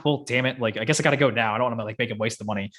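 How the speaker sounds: normal talking voice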